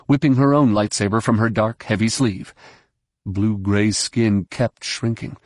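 usual speaking voice

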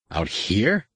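disbelieving question with a rising end; can't believe what he is seeing; incredulous. A narrator's reading. Captain repeating the wife's report, incredulous and rising. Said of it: "Han repeated incredulously".

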